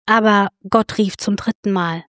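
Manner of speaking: usual speaking voice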